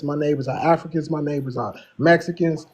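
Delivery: ordinary talk, nothing marked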